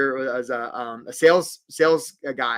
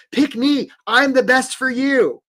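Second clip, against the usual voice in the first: high-pitched pleading sales pitch; raised, over-keen plea; mock eager job seeker; 7 semitones higher, 5 dB louder, slower than usual. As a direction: imitate someone begging to be chosen. Acting out desperate job seekers.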